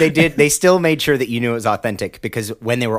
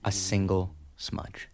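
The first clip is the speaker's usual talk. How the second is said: mock gravity; 9 dB quieter, slower than usual. Mock outrage at a tiny smudge.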